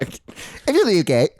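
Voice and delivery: second try at British, still off; botched British accent, another go. Shown as a demonstration.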